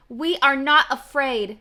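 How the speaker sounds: loud, slow scream. Acted. Frog and Toad screaming as the hawk passes. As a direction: scream it out together. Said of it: "screamed Frog and Toad".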